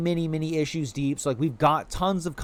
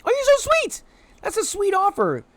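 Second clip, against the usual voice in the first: very high, cartoonish squeak; a tiny creature thanking someone sweetly; squeaky cute voice; 4 dB louder than usual. Thanking the helpful chatter in a tiny squeaky voice.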